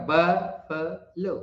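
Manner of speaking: Buffalo slowly split, weight on "BUF"; drawn-out "BUF, fuh, lo" for buffalo; stress on first syllable. Shown as a demonstration. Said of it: "ब पर ज्यादा जोर पड़ेगा".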